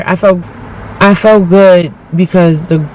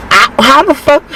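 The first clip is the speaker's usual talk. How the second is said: incredulous; 7 semitones higher than usual. Real, not acted. Incredulous exclamation.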